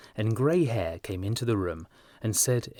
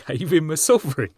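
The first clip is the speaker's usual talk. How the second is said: louder, high incredulous shout; laughing shout; 6 semitones higher, 6 dB louder than usual. A narrator's reading. Trevor shouting at Hughie's blunder, amused. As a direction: shout it back in delight. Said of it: "shouted Trevor".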